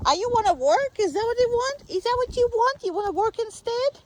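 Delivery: high dog talk. Real, not acted. Owner teasing her eager dog, in high sing-song baby talk. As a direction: coo at the dog like a puppy.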